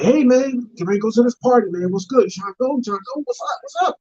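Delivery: high-pitched buddy pushing to party; eager friend. Acted. Friend coaxing him to a party, high and eager.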